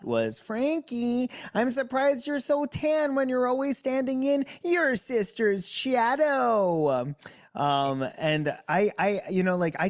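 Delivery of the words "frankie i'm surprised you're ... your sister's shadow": high, mock robot host delivering a roast; Zingbot voice. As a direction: deliver the zing like a cheeky robot emcee. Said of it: "I'm gonna say the zing that I got on Big Brother from Zingbot".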